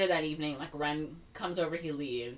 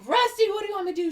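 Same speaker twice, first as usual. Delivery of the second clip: high, rushed, loud mock plea; Willard; 8 dB louder, faster than usual. Boyfriend calls to his grabbed girlfriend, frantic mock yell. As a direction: yell it desperately, very fast.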